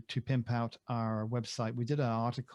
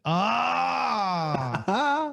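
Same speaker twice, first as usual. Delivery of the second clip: surprised cry; 9 semitones higher, 4 dB louder than usual. Real, not acted. Sees the Christmas gift.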